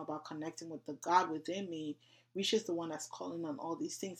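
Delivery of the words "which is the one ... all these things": usual speaking voice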